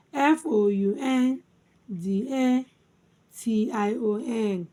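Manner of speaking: letter groups split by clear pauses; spelled by syllables. Shown as a demonstration. Teacher spelling foundation for learners, syllable by syllable. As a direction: spell it out, first chunks lifted, last settling. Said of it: "divided into syllables".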